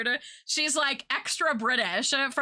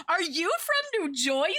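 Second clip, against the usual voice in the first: put-on higher-pitched, joking New Jersey accent; mock Jersey accent; 7 semitones higher than usual. Acting out a family's running joke, in a mock Jersey accent. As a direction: tease with a put-on Jersey "Joisey". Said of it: "you were like".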